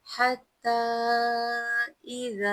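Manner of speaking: light, thin chanted हा in "हत्ता"; tajweed recitation. Shown as a demonstration. Teacher demonstrating a light हा for students, chanted. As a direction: sing the word slowly with a light हा. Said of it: "हल्का और बारीक और ठंडा पढ़ें".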